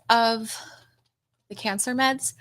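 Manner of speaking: mid-yawn. Real, not acted. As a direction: talk through a yawn. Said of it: "Sorry, I'm yawning".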